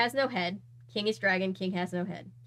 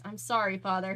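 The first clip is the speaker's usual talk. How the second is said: ordinary delivery, nothing marked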